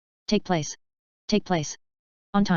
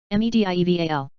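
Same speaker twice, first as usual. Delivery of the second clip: letters rattled off fast; quick spelling; 3 dB louder, much faster than usual. Shown as a demonstration. Teacher spelling the word fast for the class. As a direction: run through the letters briskly. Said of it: "spelling drill".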